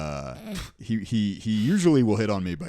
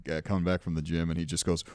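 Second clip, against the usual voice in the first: usual speaking voice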